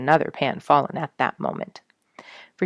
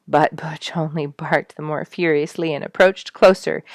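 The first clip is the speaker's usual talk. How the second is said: ordinary delivery, nothing marked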